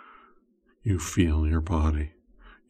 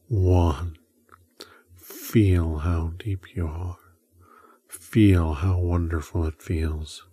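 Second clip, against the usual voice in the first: hypnotic whisper induction. Acted.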